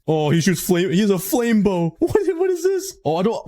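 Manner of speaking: high, panicked yelps at the skeleton; squeaking in sudden panic; panicked squeal. Real, not acted.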